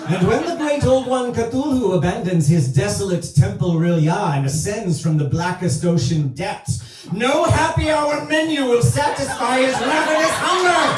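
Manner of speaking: high, mounting doomsday proclamation; hammed-up cultist waitress foretelling doom; possessed prophecy. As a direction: build to a high-pitched fevered cry on the last line.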